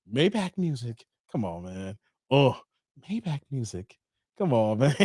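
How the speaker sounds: [name] drop. Retold or imitated. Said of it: "Why you gotta be [name]".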